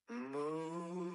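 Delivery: long drawn-out moo; one sustained, smooth moo; cow moo. Shown as a demonstration. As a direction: imitate a cow mooing. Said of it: "cow in this room mooing".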